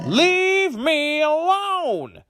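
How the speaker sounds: drawn-out, very high, loud shout. A narrator's reading. Old woman yelling back at her family as she leaves, drawn-out shout. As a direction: stretch it out in a huge high yell. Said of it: "the old woman shouted".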